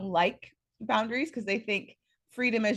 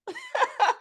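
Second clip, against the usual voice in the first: sudden very high laugh; high burst of laughter. Real, not acted. Laughs at her own joke.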